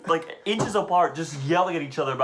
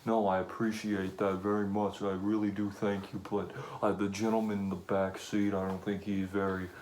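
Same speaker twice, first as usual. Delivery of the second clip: hushed, overly polite gentleman; low and soft, mock courteous; passenger's low polite refusal; 7 semitones lower, 8 dB quieter than usual. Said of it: "He's like".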